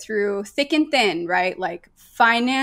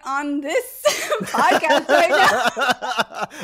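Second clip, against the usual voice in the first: laughing through the words; helpless real laughter breaking into the line; 3 semitones higher, much slower than usual. Real, not acted. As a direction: refuse while cracking up. Laughs while refusing the accent.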